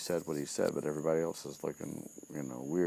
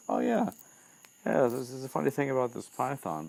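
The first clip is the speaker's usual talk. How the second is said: breezy recollection; 9 semitones higher, 4 dB louder than usual. Retold or imitated. Retold Harry casually recalls the python.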